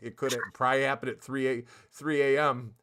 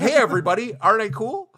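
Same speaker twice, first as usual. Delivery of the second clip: mocking host; 8 semitones higher, 10 dB louder than usual. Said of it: "mocking host intro".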